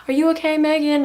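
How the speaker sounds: high, pleading mock worry; dog owner begging Megan to say she's okay, high; worried lady's voice. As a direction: pitch it up and plead. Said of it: "she was like".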